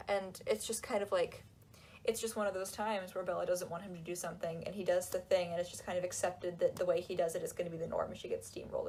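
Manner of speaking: ordinary delivery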